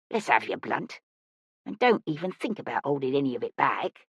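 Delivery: low, softly menacing thug voice; quiet, low threat from a young tough; mocking street bully. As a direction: demand the money like a street ruffian. Big Teeth, mocking mugger, demands money.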